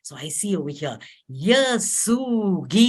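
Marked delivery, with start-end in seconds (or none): 1.3-2.8 slow, stretched Yasugi; drawn-out syllables. Shown as a demonstration. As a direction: model each syllable for the group. Teaching strung consonant sounds.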